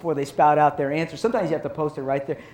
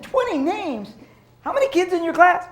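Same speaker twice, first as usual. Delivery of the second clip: mock disbelief, much higher and louder; shocked dad; 12 semitones higher, 5 dB louder than usual. Father hearing about twenty names, mock amazement. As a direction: gasp at the number like a stunned father.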